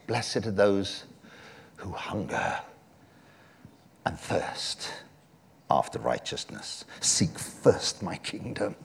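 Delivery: slow, low and soft, with long pauses; solemn, low, unhurried recital; hushed scripture quote. Retold or imitated. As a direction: let each phrase sink almost to a whisper.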